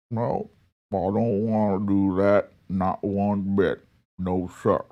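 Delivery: low, grumbling put-on senator voice; McConnell grumbling. Vlogger voicing McConnell's reply to Biden, low grumbling mock. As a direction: play the sulky old senator refusing. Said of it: "Mitch McConnell, unimpressed when Joe Biden said".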